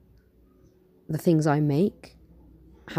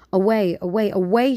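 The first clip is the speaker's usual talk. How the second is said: loud, high cry of "away"; roaring; 5 semitones higher, 7 dB louder than usual. Acted. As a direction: roar it out, climbing. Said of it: "she roared".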